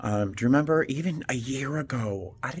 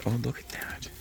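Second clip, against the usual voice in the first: whisper; 5 dB quieter than usual. Real, not acted. Whispering while shopping.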